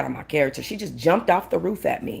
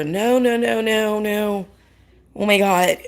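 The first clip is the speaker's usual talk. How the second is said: higher, drawn-out mock "no"; manager protesting; 4 semitones higher, slower than usual. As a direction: lightly imitate a flustered manager stepping in. Imitating the manager's alarmed refusal.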